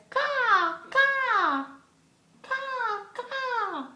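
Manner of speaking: high, falling imitated caws; bird caws. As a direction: caw like a bird, pitched well up. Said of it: "The bird says".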